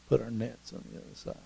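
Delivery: quick, soft, disbelieving echo; grumbling repeat. Acted. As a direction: repeat the order under your breath, sceptically. Fishermen grumble back the instruction.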